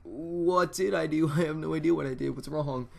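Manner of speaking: loud, high protest of innocence, easing off; mock panic. Kid answering an angry dad's text, hammed-up panic. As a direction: act out bewildered panic, first word stretched. Retelling own panicked reply to dad.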